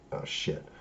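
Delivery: hushed, whispered imitation of dismay; whispered reaction. Monica reacting to Lashana's question, whispered dismay. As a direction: whisper it under your breath.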